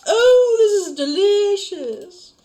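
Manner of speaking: high, slow, delighted gush; delighted character voice. Acted. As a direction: gush over the taste in a high voice. Customer character tastes drink.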